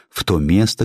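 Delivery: plain reading voice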